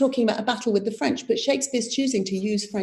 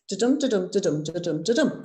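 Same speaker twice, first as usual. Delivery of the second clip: "Da-dum" said light then strong, five times; iambic beat; 3 semitones lower, 3 dB quieter than usual. Shown as a demonstration. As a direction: count the beats out evenly. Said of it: "it actually fits".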